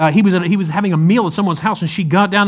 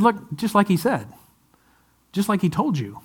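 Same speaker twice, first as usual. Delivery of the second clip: soft knowing dig; 2 semitones higher, 7 dB quieter than usual. Acted. Angel's gentle dig at women.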